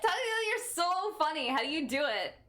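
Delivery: high, laughing mock praise; laughing sarcasm. Real, not acted. Streamer mocking her own joke, laughing high. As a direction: gush at yourself through laughter.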